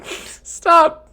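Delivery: real high, squealing laughter through the words; helpless laughter. Real, not acted. Streamer reading the chat's joke, helplessly laughing. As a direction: barely get the question out for laughing.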